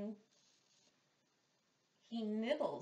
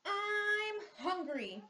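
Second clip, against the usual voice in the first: mock bear roar, higher and louder, slow; hammed-up hungry bear roar; 10 semitones higher, 6 dB louder, much slower than usual. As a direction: bear roaring to his friends. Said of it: "roars Bear".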